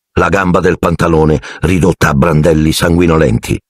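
ordinary delivery, nothing marked

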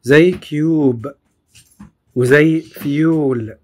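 cube and fuel stretched very slowly; slow demo. Shown as a demonstration. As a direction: draw out the long u slowly. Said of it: "لونج U". (نطق بطيء للـ U الطويلة)

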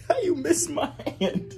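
high laughing squeal through the words; can barely get the words out for laughing; laughing hard. Real, not acted. Teasing her brother on a video call, laughing.

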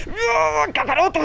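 high, drawn-out cry for rescue; desperate cry. Real, not acted. Player calling on Kakaroto in panic, desperate cry.

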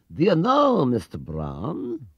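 high start, then a slow, drawn-out name; a lightly voiced kindly old shopkeeper, thoughtful; a hint of old Mister Gruber musing slowly; Mister Gruber's voice. Mister Gruber speaks to Mister Brown.

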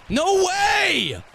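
high-pitched cry of disbelief; can't believe what you just saw; stunned disbelief. Real, not acted. Streamer reacting to a game play.

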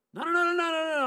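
high, loud rapid "no, no, no"; frantic denial. Retold or imitated. Young man denying he is robbing Steve, loud high frantic protest. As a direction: protest hard, voice jumping up.

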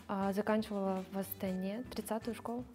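normal talking voice

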